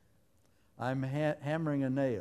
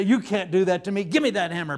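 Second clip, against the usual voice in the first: angry left hand; 3 semitones higher, 6 dB louder, faster than usual. Acted. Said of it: "My left hand would say".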